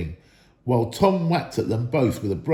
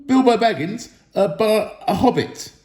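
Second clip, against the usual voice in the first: frightened stammer; 8 semitones higher, 4 dB louder than usual. A narrator's reading. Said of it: "shaking all over".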